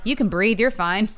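loud, pushy mock brush-off; mock brusque dismissal. Wife mimicking husband during her panic: mock brusque dismissal. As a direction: bark the reassurance at her, teasing. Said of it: "Like you do to me".

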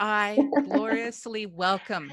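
slow, loud words bubbling with laughter; laughing. Real, not acted. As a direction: laugh through the welcome, loud. Greeting guest's offer with laughter.

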